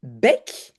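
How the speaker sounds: short open è in "Bec"; "Bec" with è as in French "jet". Shown as a demonstration. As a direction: say "Bec" with a brief open eh vowel. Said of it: "c'est comme dans jet".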